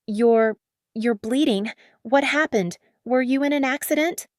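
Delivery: frightened rush of questions; panicked. A narrator's reading. Driver alarmed at bleeding man, panicked.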